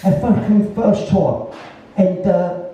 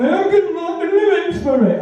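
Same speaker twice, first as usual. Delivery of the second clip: high, excited quoted producer voice; hyper producer; 10 semitones higher than usual. Retold or imitated. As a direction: mimic the wound-up producer announcing it. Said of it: "He was really hyper".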